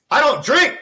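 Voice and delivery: high, loud, overjoyed cry; excited. Retold or imitated. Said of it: "And I was so excited".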